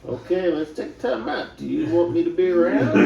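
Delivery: laughing quote. Retold or imitated. Retold reply, laughing through it.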